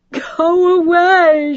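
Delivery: very high, slow sobbed plea; crying mother in his arms; sobbing. Acted. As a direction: sob the words out. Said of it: "she sobbed".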